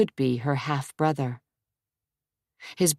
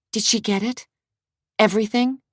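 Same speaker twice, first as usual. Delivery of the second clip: eager question; 6 semitones higher, faster than usual. Acted. Adria presses eagerly.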